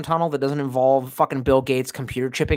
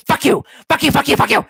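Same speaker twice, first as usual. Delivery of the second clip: much higher, louder repeated curse; mock yelling; 11 semitones higher, 6 dB louder than usual. Streamer cursing at Bill Gates, mock yelling. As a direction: scream the curses in fun.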